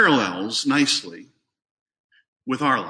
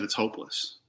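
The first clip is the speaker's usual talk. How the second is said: whispered verdict; 4 semitones lower, 11 dB quieter than usual. Retold or imitated. Onlookers' retold verdict.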